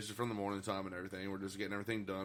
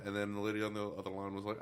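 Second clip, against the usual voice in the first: normal talking voice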